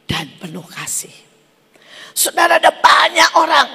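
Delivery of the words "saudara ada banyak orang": fiery preaching. Acted. Pastor urging the congregation to give, preaching at full force.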